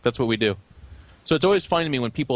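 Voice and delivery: ordinary talk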